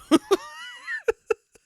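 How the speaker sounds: squealing high cackle; shrieking laugh. Real, not acted. Reviewer cracking up after cursing at Amber, shrieking laugh.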